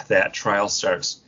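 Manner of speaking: usual speaking voice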